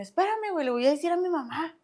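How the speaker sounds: high-pitched acted appeal to a friend; imitating herself asking him to wait; retold plea.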